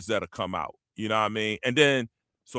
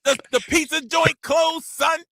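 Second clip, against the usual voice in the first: high, loud whiny New York complaint; high New York accent complaining; complaining New Yorker; 8 semitones higher, 7 dB louder than usual. Retold or imitated. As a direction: gripe like an outraged New Yorker. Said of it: "they always complain".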